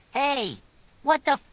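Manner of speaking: high-pitched startled objection, cut off; alarmed protest. A narrator's reading. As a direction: protest in alarm, breaking off mid-word. Character shocked at being shot.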